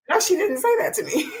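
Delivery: high, laughing incredulity; laughing disbelief. Real, not acted.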